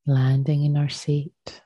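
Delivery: unhurried meditation voice with long pauses; guided meditation. Acted. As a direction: gently guide them into stillness, leaving space after each phrase.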